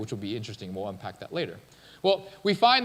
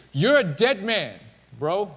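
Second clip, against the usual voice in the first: slow, loud, higher warning; forceful God; 4 semitones higher, 5 dB louder, slower than usual. Retold or imitated. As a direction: deliver the threat in God's role. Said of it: "God is super forceful".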